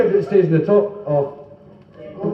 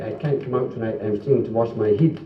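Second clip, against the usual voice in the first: put-on posh Scottish accent; lightly hammed-up posh accent, low and soft; mock posh accent; 7 semitones lower, 5 dB quieter than usual. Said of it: "people sort of speak very posh".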